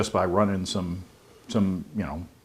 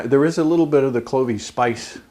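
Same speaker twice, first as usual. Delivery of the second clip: normal talking voice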